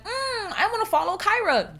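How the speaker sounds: high mock-admiring voice; eager fan. Follower gushing about an influencer, in high imitation. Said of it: "be like".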